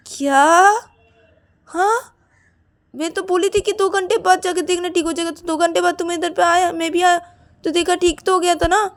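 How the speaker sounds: high, loud self-justifying rush; indignant defence. Acted. Siya defends her promise he would recover, indignant. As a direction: argue back, voice held high.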